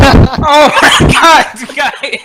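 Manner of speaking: laughing out loud in disbelief; slow, loud laugh through the words. Real, not acted. Teammate steals his kill.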